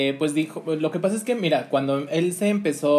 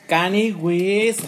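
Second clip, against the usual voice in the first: drawn-out announcement; 4 semitones higher, 5 dB louder, much slower than usual. Acted. Presenting the next guest.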